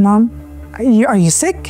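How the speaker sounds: ordinary talk, nothing marked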